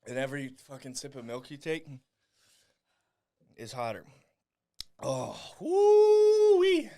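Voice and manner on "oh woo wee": high, slow, drawn-out whoop; stretched woo. Real, not acted. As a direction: ride out the heat with a whoop. Reacting to the burn.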